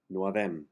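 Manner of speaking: "No havem" run together as "no-avem", no H; H dropped. Shown as a demonstration. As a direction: join "No havem" into one sound. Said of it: "drop the H and combine the two words".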